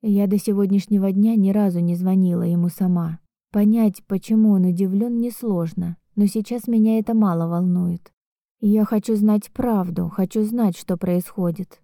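plain reading voice

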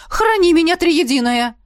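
startled prayer. A narrator's reading.

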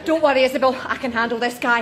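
ordinary talk

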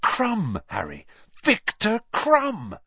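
high, loud starstruck cry. A narrator's reading. Ron telling Harry he has spotted Krum, starstruck. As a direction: gasp out the famous name in awe.

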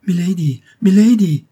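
high, loud, urgent call; rising panic in the call; alarmed cry. A narrator's reading. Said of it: "s'écria Phoebe".

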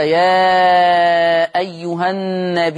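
slow chanted recitation, the long vowel in النبي held out for six counts; tajweed teacher reciting يا أيها النبي with a long held ي; Quranic recitation. Shown as a demonstration. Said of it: "أي يشبع في هذه الحالة المد المتصل، هكذا".

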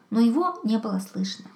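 usual speaking voice

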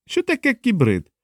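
high-pitched, slow-witted-thug question; high, womanish male voice. A narrator's reading. A henchman asks about cybrids in a high, womanish voice. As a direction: ask slowly in near-falsetto. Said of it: "з високим, майже жіночим голосом".

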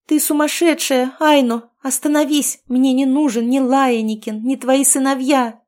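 high, loud frantic scream. A narrator's reading. Girl screams at Aino to stop. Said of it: "завопила я".

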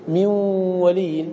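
من melts into a long, nasalised و of ولي; very slow, holding the join from من into ولي; merged nun. Shown as a demonstration. Teacher showing nun merged into waw, drawn-out recitation. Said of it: "أدغمنا النون في الواو".

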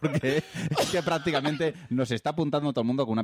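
talking through giggles; laughing while talking. Real, not acted. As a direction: laughs between the words. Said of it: "Me estoy riendo". (riendo mientras habla)

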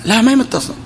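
ordinary talk, nothing marked

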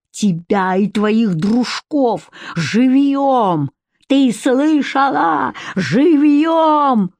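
slow, drawn-out menace rising high; enraged threat. A narrator's reading. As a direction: stretch the threat into a furious cry. Enraged man threatens burying alive.

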